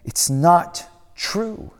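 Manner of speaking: "not" held long, very slow; firm denial. Acted. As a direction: stretch each word, firmly. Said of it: "it says again".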